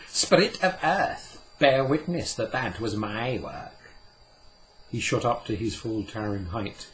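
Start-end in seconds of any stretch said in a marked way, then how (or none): 0.1-3.7 loud, proud call to bear witness; ringing like a trumpet. Acted. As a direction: ring it out like a trumpet. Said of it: "his words rang like a trumpet".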